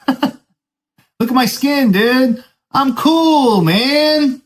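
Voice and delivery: hammed-up high slow plea; mock protest. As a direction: ham up the innocent surfer act. Mock-protesting to checkpoint guards.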